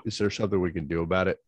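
usual speaking voice

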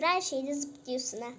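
usual speaking voice

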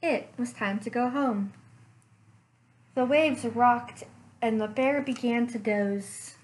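ordinary delivery, nothing marked

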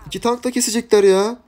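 boiling over as they cling to him; furious. Real, not acted. Streamer raging as enemies stick like leeches, loud and high. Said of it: "Bak sinirden ip atamıyorum".

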